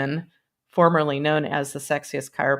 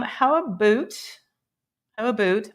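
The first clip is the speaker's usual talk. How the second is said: light mock Canadian, "about" as "aboot"; Canadian accent; 4 semitones higher than usual. Streamer playing Canadian for a second, mock Canadian accent. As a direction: put on a playful Canadian accent. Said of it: "I be Canadian for a second".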